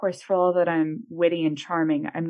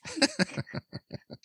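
high-pitched fit of laughing; real, pained-with-laughter giggling; helpless laughter; 7 dB quieter than usual. Real, not acted. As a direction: laugh until it hurts. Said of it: "I'm in pain".

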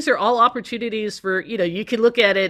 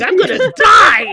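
higher and louder, a hammed-up panicked cry; mock panicked shout; 11 semitones higher, 10 dB louder than usual. Anchor mock-yelling in dread of Hawaiian place names, hammed-up panic. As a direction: yell it like a new anchor dreading Hawaiian words. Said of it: "It's like".

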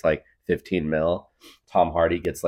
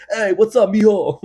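high, louder hammed-up George Lopez dad greeting; George Lopez impression; 10 dB louder than usual. George Lopez as her movie dad, playful impression. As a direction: greet your son like a sitcom George Lopez. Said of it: "George Lopez in here as my dad".

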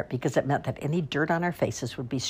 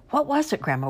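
much higher eager plea; begging kids; 12 semitones higher than usual. A narrator's reading. Kids begging grandma to tell, high and eager. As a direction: plead like a curious child. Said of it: "we kids begged".

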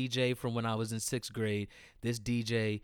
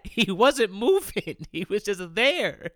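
laughing; 6 semitones higher, 6 dB louder, slower than usual. Real, not acted. Laughing while recalling friend.

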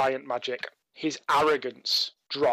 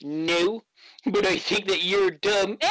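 put-on cocky kid; 5 semitones higher, slower than usual. GM voices cocky halfling leader.

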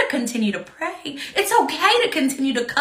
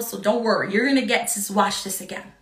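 usual speaking voice

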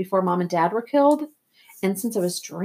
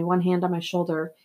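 plain reading voice

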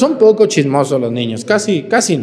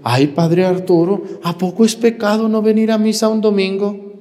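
a whiny parishioner, pitched higher; mock parishioner whining; 5 semitones higher than usual. Parishioner whining to the priest, mock pleading. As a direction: plead like a lazy churchgoer.

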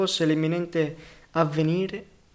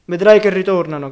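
loud, raised-voice shout; 3 semitones higher, 11 dB louder than usual. A narrator's reading. Skinny man calling after his comrades, shouting a warning. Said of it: "gridò il mingherlino".

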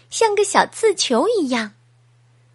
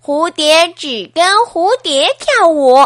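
louder, aloof refusal in another voice; haughty butterflies; 4 semitones higher, 6 dB louder than usual. Acted. 蝴蝶冷冷地拒绝哈利亚特，声音更响. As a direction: turn him down coolly.

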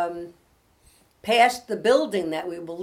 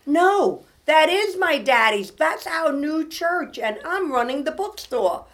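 put-on much higher, slow, insistent child's protest; indignant little girl; 10 semitones higher, 8 dB louder, slower than usual. Daughter insisting to the pastor it's daddy's church, indignant. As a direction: plant your feet like an offended six-year-old. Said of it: "she got very indignant".

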